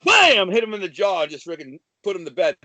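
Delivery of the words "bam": much higher, loud "Ah, bam!" for the knockout punch; punch shout. Retold or imitated. Retelling knocking a guy out, loud punch shout. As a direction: yell it like the punch connects.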